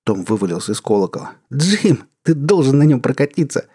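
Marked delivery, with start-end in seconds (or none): 1.5-3.7 excited. Acted. Tom thrilled after ride.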